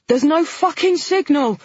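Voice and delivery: high frantic shout; shouting back. A narrator's reading. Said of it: "Nina shouted back".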